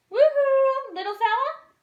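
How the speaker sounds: high, loud cheery call. Acted. Dory calling out to a stranger, loud and high. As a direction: sing it out to the little fish. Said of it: "she called".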